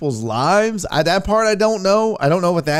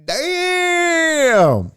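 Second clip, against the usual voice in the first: 1 stunned damn; 9 semitones higher, 3 dB louder than usual. Real, not acted. Reacting to Carl Lewis roast.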